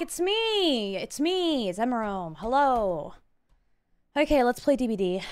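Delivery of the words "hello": normal talking voice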